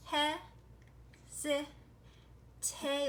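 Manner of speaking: slow, split into syllables with pauses. Shown as a demonstration. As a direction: sound it out one piece at a time. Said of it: "Look, there's that long A".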